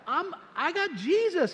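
high and slow, beaming with joy; joyful announcement. Retold or imitated. Telling his friend he found Jesus, overjoyed and high. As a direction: lift it up, he can't contain it.

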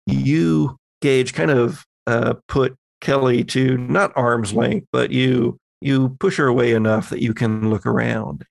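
ordinary talk, nothing marked

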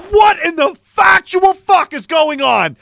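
high, loud, slow outraged shout; dying disbelief. Retold or imitated. Dying man yelling his last thought at the bear. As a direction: bellow it out as your last words. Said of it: "what other thoughts could you have other than".

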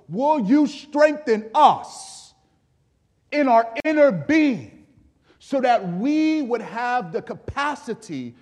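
high, forceful plea to God; impassioned preached prayer. Acted. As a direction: plead it from the pulpit, drawing out key words.

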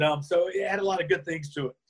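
ordinary talk

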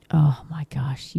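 slow, unvoiced "oh my gosh" in disbelief; real dismayed whisper. Real, not acted. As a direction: let it slip out as a whisper, too upset to say it aloud. Reacting to a disturbing article.

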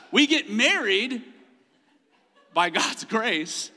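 ordinary delivery

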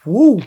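loud high woo after a drink; refreshed whoop. Real, not acted. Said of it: "That woke me back up".

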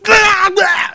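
shrill, high, mock screams of people melting. Viewers mimicking the TV crowd melting, a high mock scream. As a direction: squeal it like you're dissolving on the spot. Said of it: "and they went".

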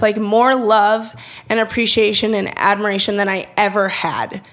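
normal talking voice

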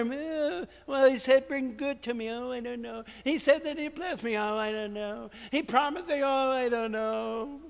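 soft, doubtful mumbling congregant; mock a bored listener's doubt; a hammed-up, unconvinced churchgoer; mocking unsure listener. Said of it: "You're all sitting there".

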